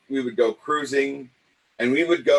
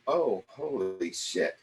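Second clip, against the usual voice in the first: quiet shock; 4 semitones lower, 7 dB quieter, slower than usual. Retold or imitated. Later realization retold.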